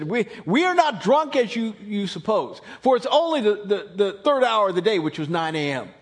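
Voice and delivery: loud, firm denial; insistent. Retold or imitated. Peter answering the mocking crowd, loud and firm. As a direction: set the crowd straight, loudly. Peter denies being drunk.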